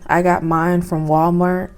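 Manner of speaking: normal talking voice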